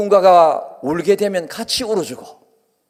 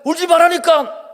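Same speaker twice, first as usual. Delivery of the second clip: mock scold, higher pitch; exasperated scolding parent; 7 semitones higher than usual. A parent scolding a baby who keeps crying, irritated. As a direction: snap at the crying child, hammed up. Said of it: "자꾸 울면".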